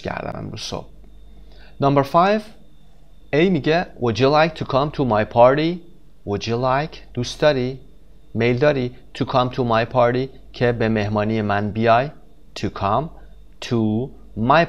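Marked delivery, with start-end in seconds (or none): none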